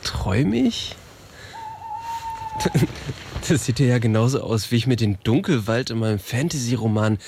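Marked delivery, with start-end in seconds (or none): 1.5-3.4 odd laugh. Acted. Strange new voice reacts.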